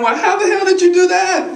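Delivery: high-pitched audience question; mock wondering. Audience member wondering about the kids, mock wondering. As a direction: ask it high, playing the baffled listener.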